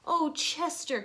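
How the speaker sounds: cried out. A narrator's reading. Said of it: "cried Missus Raccoon".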